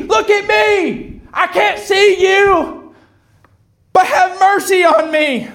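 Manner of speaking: high, drawn-out desperate shout; acted high-pitched pleading cry. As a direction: yell to be noticed like a blind beggar. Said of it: "He cried louder".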